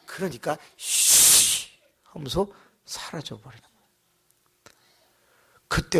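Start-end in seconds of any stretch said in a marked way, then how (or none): 0.8-1.7 mock hiss. Demon vanishes.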